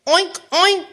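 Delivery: high little piggy oinks; two high storybook oinks. A narrator's reading. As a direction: oink like a piglet. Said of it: "said a piggy".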